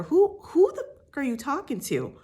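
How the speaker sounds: high, loud confrontational challenge; indignant comeback. Pretend. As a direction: play at going off on someone. Imagined angry comeback to Raquel.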